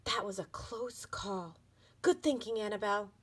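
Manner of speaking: breathy relieved whisper; soft whisper. Acted. Tiffany hiding in the backpack, whispering her relief. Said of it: "exclaimed Tiffany softly".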